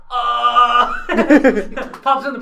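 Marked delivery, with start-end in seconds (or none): 0.1-0.9 loud guard grunt. Retold or imitated. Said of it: "the guards go".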